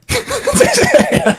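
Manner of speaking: laugh. Real, not acted. Laughing after teasing.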